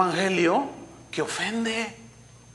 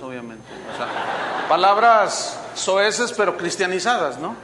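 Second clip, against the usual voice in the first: usual speaking voice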